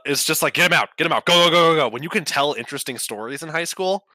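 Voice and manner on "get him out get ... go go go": loud rushing go go go; urgent hurry. Pretend. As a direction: hurry them along, pushy. Rushing Peter through school.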